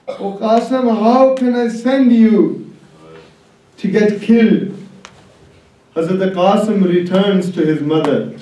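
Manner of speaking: normal talking voice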